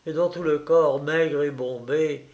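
plain reading voice

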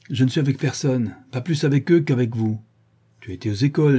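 ordinary delivery

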